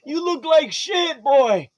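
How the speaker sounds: high, slow gruff-fond father; old dad voice. Acted. Old dad teasing son Hallman, high slow voice. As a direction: tease him lovingly. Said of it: "Hallman, like".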